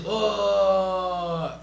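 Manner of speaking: be the whole crowd going oh; crowd reaction. Retold or imitated. Coach imitating the crowd reacting to the big spot, loud. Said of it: "crowd goes".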